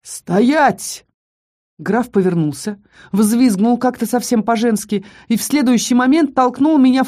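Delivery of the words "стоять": menacing, booming command; thundering menace. A narrator's reading. Unseen newcomer halting the count, menacing boom. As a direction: thunder the order to halt. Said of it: "прогремело грозным голосом".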